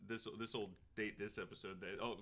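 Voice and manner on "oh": ordinary delivery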